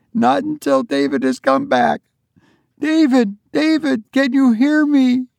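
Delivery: high, insistent call to her son; refuse, then call out in a high voice; desperate wife. A narrator's reading. Wife refusing to leave, desperately calling.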